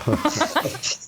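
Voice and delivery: quick amused high laugh; short laugh. Real, not acted. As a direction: laugh off the joke lightly. Joking about who answers first.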